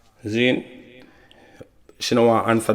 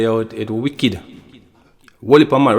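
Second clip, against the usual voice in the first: ordinary talk, nothing marked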